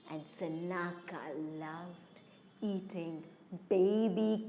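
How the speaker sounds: low, hushed narration with long pauses; ominous storytelling. A narrator's reading. Storyteller revealing the hidden snake, low ominous hush. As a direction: drop low and soft, drawing out the menace.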